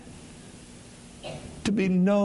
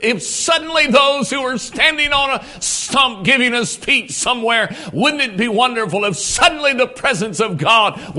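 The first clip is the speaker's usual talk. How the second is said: louder, higher preaching build; fervent preaching; 6 semitones higher, 4 dB louder than usual. Acted. Preacher urging the congregation about the nation, fervent preaching. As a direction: rouse the congregation with rising passion.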